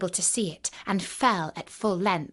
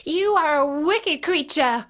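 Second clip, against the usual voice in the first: put-on higher-pitched angry little girl; indignant cry; 7 semitones higher, 4 dB louder than usual. A narrator's reading. Dorothy accusing the wicked witch, indignant cry. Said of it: "cried Dorothy".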